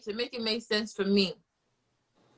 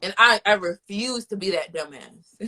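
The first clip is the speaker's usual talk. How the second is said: usual speaking voice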